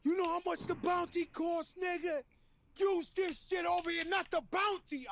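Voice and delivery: scolding his son's friend, a lightly hammed-up angry father; stingy angry father. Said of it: "He was like".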